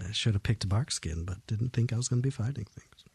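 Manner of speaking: whispered aside. Real, not acted. Muttering aside to self.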